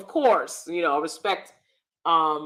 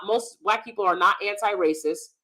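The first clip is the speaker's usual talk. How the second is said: usual speaking voice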